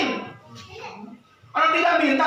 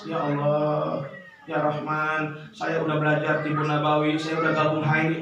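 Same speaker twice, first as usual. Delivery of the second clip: natural chat with God; 10 semitones lower, 4 dB quieter than usual. Shown as a demonstration. Preacher demonstrating a natural, intimate prayer, soft and unhurried. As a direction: talk to Allah softly like to a friend.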